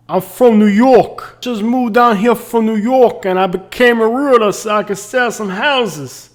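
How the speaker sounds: put-on loud, high-pitched New York accent; New Yorker accent. Realtor answering a client about moving down, New York accent. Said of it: "picked up a New Yorker accent".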